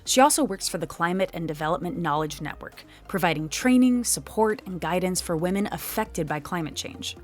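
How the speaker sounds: lively, emphatic guest introduction; podcast presenter read. Acted. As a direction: introduce the expert like a polished radio host. Host's scripted guest introduction.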